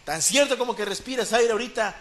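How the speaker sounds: ordinary delivery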